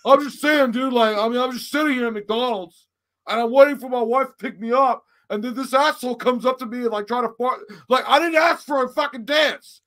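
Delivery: high, loud, exasperated retelling; worked-up protest. Retold or imitated. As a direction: protest your innocence, getting heated. His own protest in retold quarrel.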